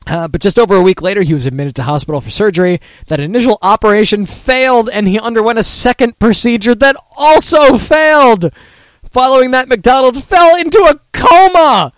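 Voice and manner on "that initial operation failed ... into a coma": rising to a loud, high peak; fighting laughter while reading it out; laughing disbelief. Real, not acted. Host reading a man's worsening medical news, laughing in disbelief. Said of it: "Why am I laughing".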